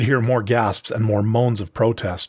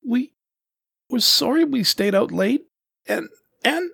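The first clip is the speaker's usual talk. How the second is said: very high, halting little voice; scared kitten. A narrator's reading. Serval cub apologizes to the panther, timid and faltering. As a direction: stammer an apology like a frightened child.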